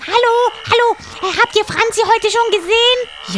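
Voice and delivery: high, loud parrot voice; a frantic parrot urging; excited parrot. A narrator's reading. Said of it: "rief er". (hohe, aufgeregte Papageienstimme)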